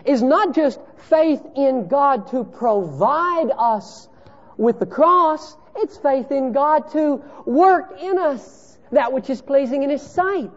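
high, loud pulpit preaching; preaching at full force. Acted. Preacher pressing the congregation on faith, in full voice. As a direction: drive the point home from the pulpit.

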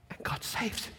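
breathy, hushed awe; awed whisper. Acted. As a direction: whisper it in wonder. Awed climax of the story.

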